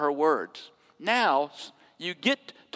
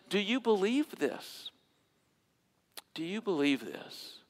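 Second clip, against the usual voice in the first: softening question; 8 dB quieter than usual. Acted. Jesus asking Martha, dropping to a soft hush. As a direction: draw them in quietly.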